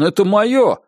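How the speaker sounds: high-pitched mock begging; abject pleading. A narrator's reading. Narrator voicing a man begging for what's his, abjectly pleading. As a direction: whine out a humiliated plea. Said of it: "униженно взмолился он".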